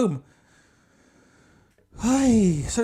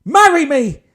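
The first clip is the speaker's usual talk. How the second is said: loud, higher sudden blurt; blurted shout; 7 semitones higher, 11 dB louder than usual. Retold or imitated. Proposing to the stranger in a loud, high blurt. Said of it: "Just blurted".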